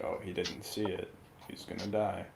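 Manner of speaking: ordinary delivery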